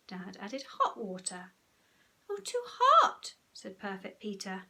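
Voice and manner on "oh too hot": put-on high, loud child's whine; little boy protesting; whiny complaint. A narrator's reading. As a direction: squeal the complaint, drawn out. Story child complaining in bath.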